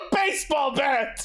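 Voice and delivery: shouted through laughter; cracking up while blurting it out; laughing. Real, not acted. Laughing at a dumb scene.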